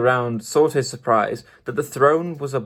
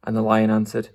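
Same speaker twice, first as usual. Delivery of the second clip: ordinary delivery, nothing marked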